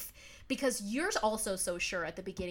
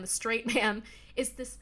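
ordinary delivery

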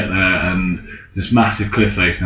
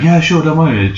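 higher, airy imitation of the trainer; a lightly put-on breezy reassurance; a voice the real trainer never had; mock trainer voice; 7 semitones higher than usual. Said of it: "She didn't sound like that".

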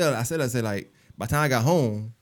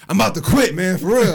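fed-up worker; 7 semitones higher, 9 dB louder than usual. Retold or imitated. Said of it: "He was like".

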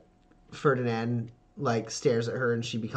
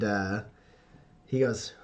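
ordinary delivery

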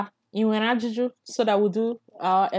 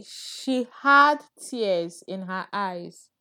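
over enunciating, slowed right down; slow and clear; much slower than usual. Shown as a demonstration. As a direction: read it out slowly, word by word. Teacher setting a phrase to translate.

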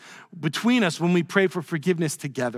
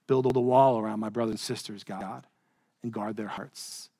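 prayer; 8 semitones lower, 5 dB quieter than usual. Acted. Prays aloud mid-sermon.

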